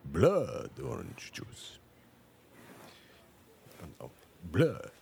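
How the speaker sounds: slow vampire, relishing the word blood; Lugosi vampire voice. Pretend. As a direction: linger on blood like Dracula. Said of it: "stereotypical Romanian Lugosi era vampire voice".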